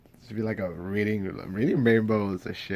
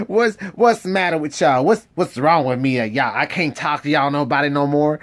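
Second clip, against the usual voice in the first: higher, louder mock girl's whine; Curley's wife voice; 5 semitones higher, 5 dB louder than usual. Retelling the audiobook, the girl complaining in a high voice. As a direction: whine like a fed-up ranch wife. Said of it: "the girl was being like".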